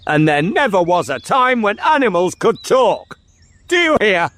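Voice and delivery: high-pitched, emphatic tirade; angry scolding. Acted. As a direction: lay down the law to a child. King scolds and shakes the boy.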